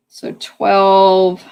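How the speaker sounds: rough, worn-out voice; hoarse voice. Real, not acted. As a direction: voice going hoarse. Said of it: "I'm losing my voice here".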